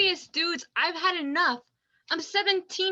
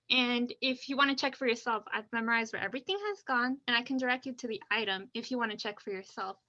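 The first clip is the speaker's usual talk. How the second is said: rushed; 3 semitones lower, 3 dB quieter, much faster than usual. Acted. Teen rushes through her list.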